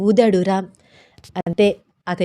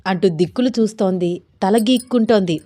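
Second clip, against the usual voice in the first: ordinary delivery, nothing marked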